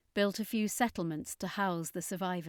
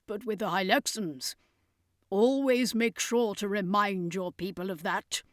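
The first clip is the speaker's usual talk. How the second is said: circus master; 3 semitones higher, 5 dB louder than usual. A narrator's reading.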